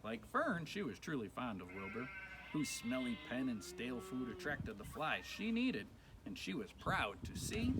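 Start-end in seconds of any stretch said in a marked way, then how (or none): none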